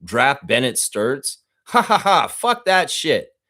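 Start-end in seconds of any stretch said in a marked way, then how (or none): 1.6-2.3 high, loud mocking ha ha ha; mocking laugh. Real, not acted. Mocking ESPN draft advice. 2.3-3.4 higher, louder, slow contempt; scornful dismissal. Real, not acted. Rejecting ESPN draft advice.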